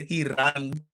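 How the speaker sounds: put-on Russian-accented "He running" through laughter; laughing, blunt Russian accent; mock Russian accent. Teasing the runner again, in mock Russian accent. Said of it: "I'ma take my Russian accent".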